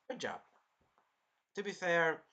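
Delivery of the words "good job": softer dropped-down "Good job"; muttered praise. Shown as a demonstration. Repeating the praise to himself, softly. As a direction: let it fall away quietly.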